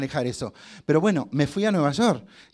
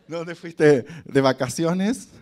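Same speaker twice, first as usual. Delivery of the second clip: mock teacher question; 4 dB louder, much slower than usual. Acted English teacher question.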